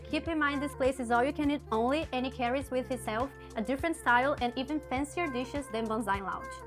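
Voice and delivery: normal talking voice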